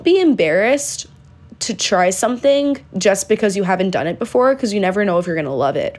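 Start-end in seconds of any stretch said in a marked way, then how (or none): none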